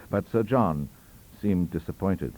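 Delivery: ordinary delivery, nothing marked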